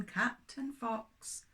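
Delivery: ordinary delivery, nothing marked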